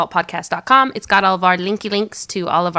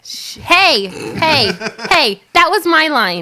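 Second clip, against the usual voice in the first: mock protest; 8 semitones higher, 4 dB louder, much slower than usual. Real, not acted. Protesting a stolen line.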